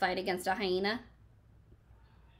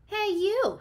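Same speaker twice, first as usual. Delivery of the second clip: high, loud little bug call; cranky ladybug calling out; grouchy ladybug; 12 semitones higher, 5 dB louder than usual. Acted. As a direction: bark it like a cranky tiny bug. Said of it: "said the grouchy ladybug".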